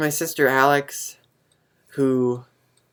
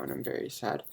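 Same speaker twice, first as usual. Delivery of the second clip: nearly whispered, very soft; quiet sad aside; 4 semitones lower, 13 dB quieter than usual. Real, not acted. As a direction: let it slip out under the breath. Missing a friend far away.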